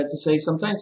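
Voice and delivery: usual speaking voice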